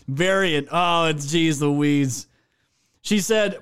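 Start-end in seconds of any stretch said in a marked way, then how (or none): none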